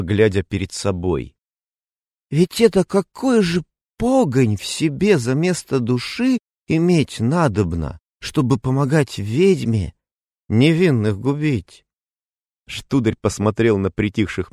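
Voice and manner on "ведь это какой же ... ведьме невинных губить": put-on drunk voice. A narrator's reading. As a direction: muse aloud, tipsy. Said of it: "продолжил хмельным голосом".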